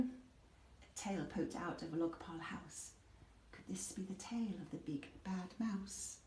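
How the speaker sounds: hushed suspense. A narrator's reading. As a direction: draw them in quietly. Suspenseful discovery.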